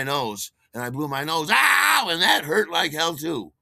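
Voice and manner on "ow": high loud ow; pained yelp. Retold or imitated. Blowing his nose on the cut, high loud pained ow. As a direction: cry out at the sting.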